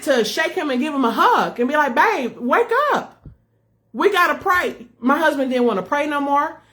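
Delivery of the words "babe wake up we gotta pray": high, drawn-out plea to a partner; acted-out pleading. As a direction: urge your husband to snap out of it. Imagined plea to shake husband awake.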